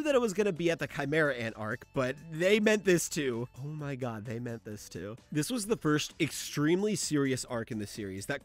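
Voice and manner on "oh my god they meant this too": much softer and lower; hushed realization. Acted. Reviewer realizing which dark arc was meant, hushed. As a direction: repeat it under the breath, stunned.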